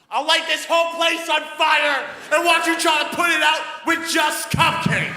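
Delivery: high, loud, furious yell; shouted threat. Retold or imitated. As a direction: explode at the manager. Furious threat after "really calmly.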